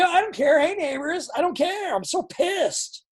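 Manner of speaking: yelling high and loud, pissed off; furious yell, stretching out the last line; livid yelling. Shown as a demonstration. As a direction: blow up at the neighbours. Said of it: "and you just scream".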